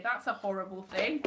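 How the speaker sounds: ordinary talk, nothing marked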